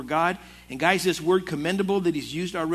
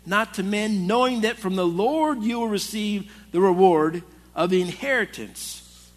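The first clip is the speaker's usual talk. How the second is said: scripture reading; 3 semitones higher, 3 dB louder than usual. Acted. Preacher reads Colossians aloud.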